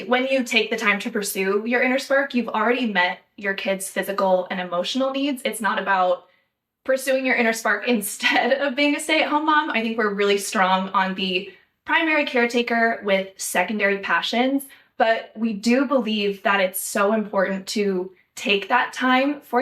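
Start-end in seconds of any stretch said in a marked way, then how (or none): none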